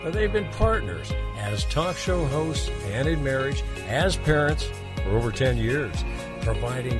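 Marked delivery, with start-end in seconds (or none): none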